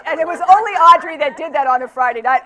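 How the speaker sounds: loud, high, talking through laughter; laughing tease. Real, not acted. Speaker teasing the audience member about Friday nights, laughing. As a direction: tease them while still laughing.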